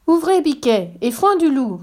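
high, lively singsong call; mother goat's high cry. A narrator's reading. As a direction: call the password brightly to your children. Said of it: "crie la chèvre".

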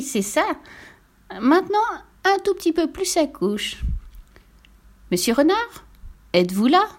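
high, politely bossy little pig; demanding piglet. Acted. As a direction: order the massage in a high piglet voice. Piglet directs the massage. (petit cochon exigeant, voix aiguë)

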